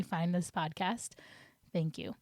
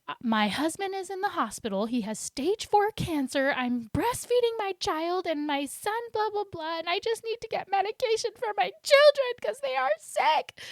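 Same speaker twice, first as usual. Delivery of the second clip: high, strained, tearful pleading voice, much higher; frantic crying plea; 11 semitones higher, 3 dB louder than usual. Retold or imitated. Mom at the pharmacy counter, tearfully pleading in a high, frantic voice. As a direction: retell the breakdown with a rising, tearful wail. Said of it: "I start freaking out and just being like".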